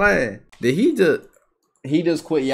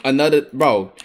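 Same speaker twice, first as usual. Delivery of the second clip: ordinary talk, nothing marked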